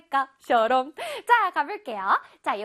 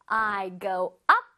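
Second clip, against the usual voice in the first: slow example read; much slower than usual. Shown as a demonstration. Teacher reading example to children, very slow. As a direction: read "I go up and down on the seesaw" very slowly. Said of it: "다시 한번 해볼까요?".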